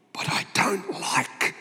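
whispered confession, slow; slow whispered admission; hushed aside. Real, not acted. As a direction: let it slip out in a whisper. Confiding aside to audience.

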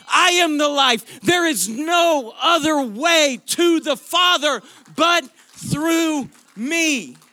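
loud, high, full-force preaching; emphatic, arresting declaration. Acted. Preacher speaking as Jesus to arrest the congregation, emphatic and loud. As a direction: proclaim it forcefully, hammering each phrase. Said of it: "that is how Jesus talks in this moment".

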